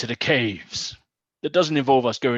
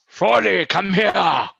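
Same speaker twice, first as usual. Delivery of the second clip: put-on calls the boy over, raised and high; commanding call; 8 semitones higher, 5 dB louder, slower than usual. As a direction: summon him like a lord. Lord summons the boy.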